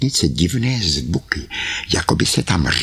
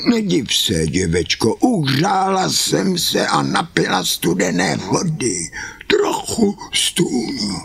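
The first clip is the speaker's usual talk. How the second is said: high, whining put-on old woman; wolf faking a sick granny; 8 semitones higher than usual. The wolf pretending to be ill grandmother, high and whiny. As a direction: whimper like a poorly granny to fool her.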